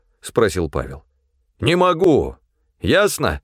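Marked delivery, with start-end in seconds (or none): 1.6-3.4 high, forceful retort; exasperated refusal. A narrator's reading. Supply man refusing Pavel's demand to go on, exasperated. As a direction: snap back, at the end of your strength.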